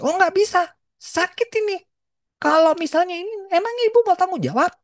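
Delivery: mock-voiced arrogant doctor refusing the mother; harsh doctor. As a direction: snap back like an impatient surgeon. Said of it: "Dia bilang gitu".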